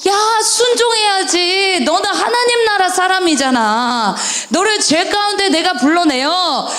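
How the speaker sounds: high, pleading, exasperated God; God pleading with children to obey. Acted. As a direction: call out as God bursting with frustration. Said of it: "하나님은 속이 터지는 거야".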